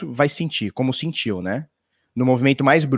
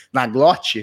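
normal talking voice

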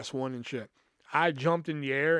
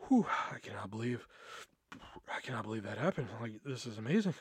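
breathless; 4 semitones lower, 7 dB quieter, faster than usual. Retold or imitated. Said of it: "I'm out of breath".

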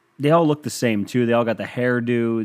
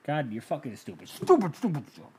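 hushed self-reproach rising to a higher pitch; mock self-scolding; 4 semitones higher, 6 dB quieter than usual. Host scolding himself for a botched accent, mock self-reproach. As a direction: berate yourself under your breath, hammed-up.

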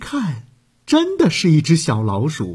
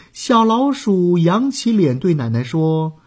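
plain reading voice

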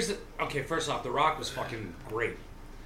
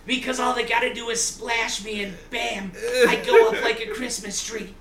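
Mort voice; 7 semitones higher, 6 dB louder than usual. Pretend. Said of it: "You sounded like that dude from fucking Family Guy".

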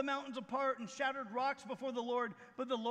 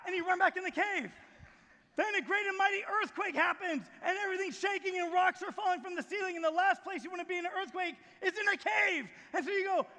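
high, raised storytelling voice; preaching at full force; 7 semitones higher, 5 dB louder than usual. Acted. Preacher retelling the tornado on the mountain, full-force preaching. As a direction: build the storm in a raised, urgent voice.

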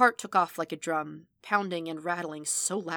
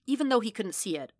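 ordinary delivery

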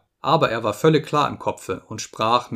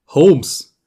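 loud, higher call; 4 semitones higher, 9 dB louder than usual. A narrator's reading. Visitor calling to the sick Holmes, loud and sharp. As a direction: call sharply to rouse someone. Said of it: "rief er".

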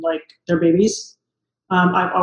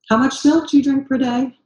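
doctor's questions; 5 semitones higher than usual. Retold or imitated. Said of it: "the questions they ask me".

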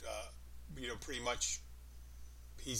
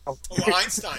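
louder, higher mock praise "Oh, Einstein"; sarcastic; 6 semitones higher, 12 dB louder than usual. Shown as a demonstration. Mocking someone who said something dumb, sarcastic.

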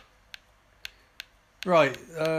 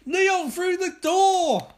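urgent shouted orders; 13 semitones higher, 4 dB louder than usual. Acted. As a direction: bark orders in a panic. Yelling orders mid-fight.

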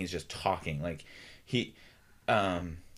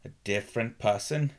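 slower cadence; 4 semitones higher, 5 dB louder than usual. Shown as a demonstration. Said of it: "a lower, a slower and broken".

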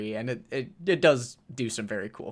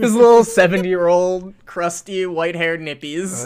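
laughing; 4 semitones higher, 12 dB louder than usual. Real, not acted. Laughing joke.

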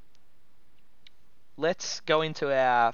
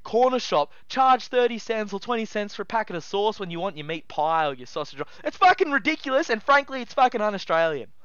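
worked up rant; 7 semitones higher than usual. Real, not acted. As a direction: rage about it at full pitch. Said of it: "I'm getting real worked up over it".

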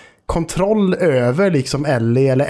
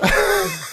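short high-pitched laugh; real high giggle. Real, not acted. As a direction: laugh after your own outburst. Laughs after acting it out.

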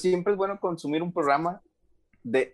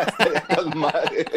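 laughing through the words; words spilling out in a laugh; 3 semitones higher than usual. Real, not acted. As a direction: giggle it out, amused. Laughing at TikTok party joke.

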